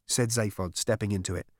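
ordinary delivery, nothing marked

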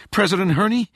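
high, loud urgent blurt. A narrator's reading. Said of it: "Rachel blurted".

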